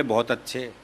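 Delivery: low, soft murmured praise; quiet approval. Retold or imitated. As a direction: murmur it softly, pleased. Parents approving child's commentary.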